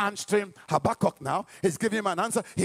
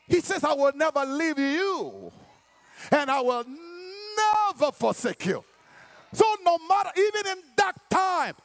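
full-force preaching; 8 semitones higher than usual. Real, not acted. Preacher assuring congregation of God's presence, full-force preaching. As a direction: preach it with long, rising emphasis.

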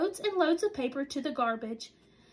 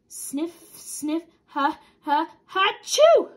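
sniffs building to sneeze; 2 semitones higher, 8 dB louder, much slower than usual. Acted. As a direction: build the sneeze then burst. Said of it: "sniffing and sneezing".

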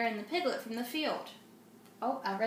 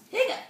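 louder squeaky hiccup on the word; high hiccup; 10 semitones higher, 6 dB louder than usual. Acted. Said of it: "Peter the Piglet's hiccups".

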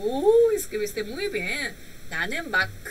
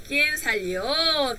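slow model; 2 semitones higher, much slower than usual. Shown as a demonstration.

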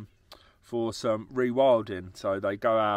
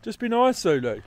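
scolding a pet; 10 semitones higher, 7 dB louder than usual. Real, not acted. Scolding a biting meerkat.

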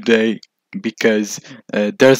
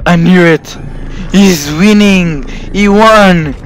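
ordinary talk

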